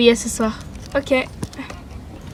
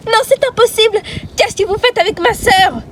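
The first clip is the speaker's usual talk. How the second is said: high loud disbelief; loud high outrage; shocked outrage; 10 semitones higher, 4 dB louder than usual. Acted. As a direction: confront them, shocked. Heroine confronts villains.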